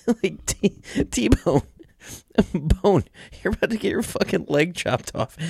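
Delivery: cracking up, can't believe the nerve; laughing in disbelief. Real, not acted. Incredulous laughing at friend's video.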